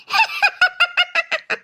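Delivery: high staccato ghost laugh; eerie, high-pitched villain laugh; evil cackle. Acted. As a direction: cackle gloatingly at the victim. Ghost laughs at cries for help.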